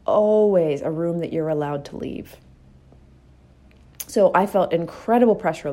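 ordinary talk, nothing marked

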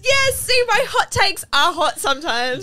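high, loud, gleeful vindication; excited triumph. Real, not acted. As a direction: crow about being proved right. Thrilled friend agrees with them.

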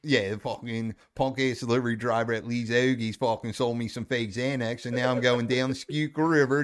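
hammed-up Delco rant in local twang; Delco accent. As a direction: play the complaining Delco guy. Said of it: "that's a Delco accent".

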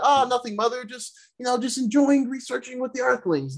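high-pitched, sheepish son covering up; innocent excuse. Retold or imitated. Thor answering his mother when caught, mock-innocent excuse.

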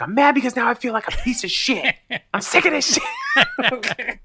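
exasperated high outburst cracking into laughter; laughing rant. Retold or imitated. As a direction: rant half laughing at yourself. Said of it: "I'm like".

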